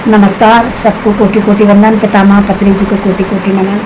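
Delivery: low, reverent closing gratitude; devotional thanks. Acted. Meditator thanking teacher and cosmos, low and reverent.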